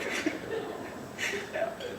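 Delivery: choked laugh through tears; tearful laugh. Real, not acted.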